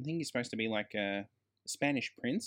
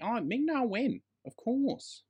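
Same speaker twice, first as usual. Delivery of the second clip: gushing praise; 11 semitones higher than usual. Real, not acted. Gushing over the actress.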